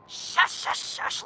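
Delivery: whispered shush to cut someone off; unvoiced shushing; urgent hush. Real, not acted. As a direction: hiss them quiet. Hushing the dispatcher to interrupt.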